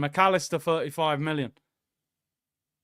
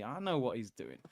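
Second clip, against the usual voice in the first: quiet knowing aside under the breath; half to himself, dropping the voice right down; knowing mutter; 6 semitones lower, 12 dB quieter than usual. Real, not acted. Streamer muttering to chat that he's onto James.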